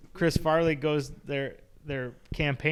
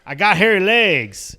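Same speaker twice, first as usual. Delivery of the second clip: mock boast; 4 semitones higher, 11 dB louder, slower than usual. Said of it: "you know what you can't say about B J".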